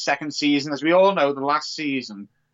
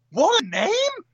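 high-pitched excited admiration; real delighted amazement; 11 semitones higher, 3 dB louder, slower than usual. Real, not acted. Podcaster hearing the actor's name, thrilled. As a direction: voice leaps up, bowled over.

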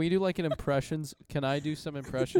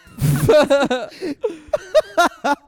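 big laugh; 14 semitones higher, 14 dB louder than usual. Real, not acted. Laughs at own impression.

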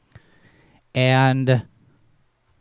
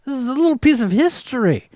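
outraged disbelief; 13 semitones higher than usual. Retold or imitated. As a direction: squeal it in disbelief. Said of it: "I'm like".

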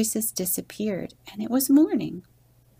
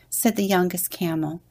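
ordinary delivery, nothing marked

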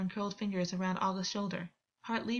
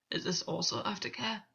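hoarse with pain. A narrator's reading. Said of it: "voice hoarse with pain".

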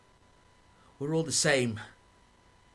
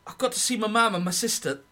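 agitated plea; 8 semitones higher, 6 dB louder than usual. Acted. Deserting soldier, distressed, pleading.

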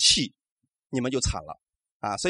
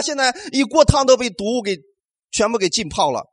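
ordinary delivery

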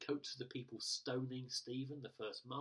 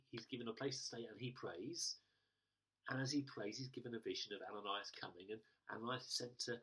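ordinary delivery

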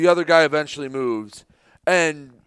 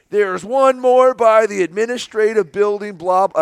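raised, louder scolding official; mock scolding; 6 semitones higher, 6 dB louder than usual. Official pointing out the last car's headlights, mock scolding. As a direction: imitate an official calling out one more offender. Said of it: "it was like they were scolding".

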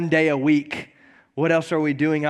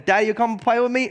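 put-on higher pleading little girl voice; toddler voice; 5 semitones higher, faster than usual. Daughter asking dad to play, high and pleading. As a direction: beg like a small child. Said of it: "she kept on asking me".

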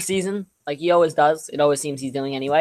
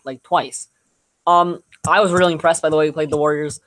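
ordinary talk

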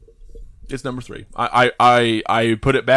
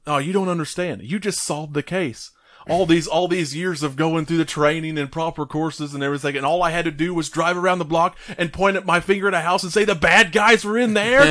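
high-pitched exasperated rant, building louder; mock pissed off; 7 semitones higher, 3 dB louder than usual. Retelling the dumbfounded cop's reaction, mock angry rant. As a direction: imitate an indignant tirade, climbing to a loud high finish. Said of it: "he's all pissed".

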